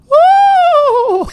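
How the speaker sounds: squeaky falsetto ooh; high falsetto. Retold or imitated. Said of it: "make my voice that high".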